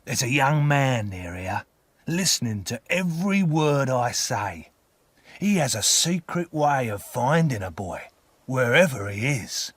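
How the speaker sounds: put-on frighten the child as a coarse convict; menacing convict. A narrator's reading. Convict warns of a hidden young man, menacing.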